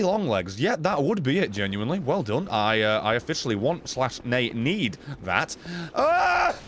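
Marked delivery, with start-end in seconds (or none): 5.9-6.6 high-pitched cry of alarm; sudden high "ah"; startled yell. Real, not acted. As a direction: yelp as if just hit. Hit during the game.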